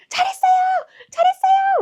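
very high, loud praise; piercing high congratulatory shout; imitated cheering. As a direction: cheer from far down the road. Woman cheering in mirror, imitated.

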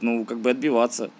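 normal talking voice

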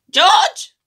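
high screech called through from another room; screechy voice from next room. Acted. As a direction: shriek the boy's name from next door. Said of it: "came the screechy voice from the next room".

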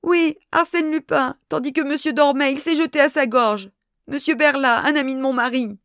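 high, distraught lady's plea; tearful but still high-pitched. A narrator's reading. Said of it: "elle reprit éplorée".

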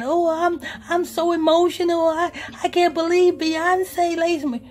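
lightly imitating a starstruck crier. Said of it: "[name] was so crying".

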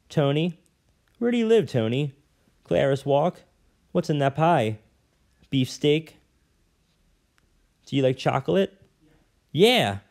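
a hint of a young street boy; light boy voice. A narrator's reading. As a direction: answer like a wary small boy. Boy answers lady in story.

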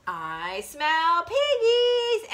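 slow, drawn-out, rising wolf call; big bad wolf. Big bad wolf sniffing out the pigs, slow and high. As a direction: savour the scent, stretching each word high. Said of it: "And the wolf says".

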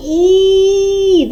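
held, high drawn-out І with smiling lips; long vowel І. Shown as a demonstration. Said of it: "Давайте разом з вами його вимовимо". (протяжне високе «І» з усмішкою)

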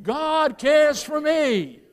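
"God cares for me" shouted, slow and loud; shouted like you mean it. Shown as a demonstration. Preacher leading the church in a shouted response, slow and loud. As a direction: belt "God cares for me" out slowly. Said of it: "Now shout it like you mean it".

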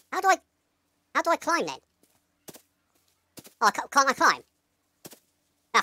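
normal talking voice

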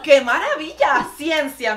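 loud, exuberant exclamation; amazed delight. Real, not acted. Vlogger marvelling at the hair experiment, thrilled. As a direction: proclaim it with theatrical wonder.

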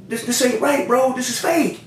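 high-pitched indignant insistence; retold protest. Telling the supplier the product is fake, retold indignant protest.

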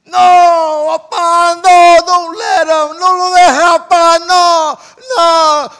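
put-on full-throated wailing child, very high and loud; acted hysterical crying shout; crying plea. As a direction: scream through tears, begging Papa to stop him. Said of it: "we start crying".

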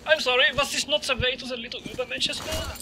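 high-pitched mock German accent. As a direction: feign innocence in a silly German accent. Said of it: "making stupid German accents".

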